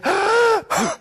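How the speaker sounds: sudden high gasp, sucking in a big breath; a demo gasp showing an unbalanced breath; sharp panicked gasp. Shown as a demonstration. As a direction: suck in a big breath fast, as if startled. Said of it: "Let's give an example of, uh, an imbalance".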